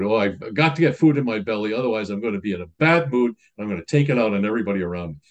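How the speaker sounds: fast, hurried griping; a hint of a cranky hungry person, fast; rushed grumbling complaint. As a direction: rattle it off like a hungry grump. Voicing a hungry angry person.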